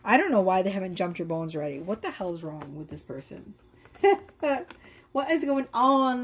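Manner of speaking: ordinary talk, nothing marked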